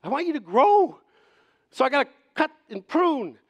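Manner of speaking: high, loud acted insistence; insistent gardener. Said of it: "It's like".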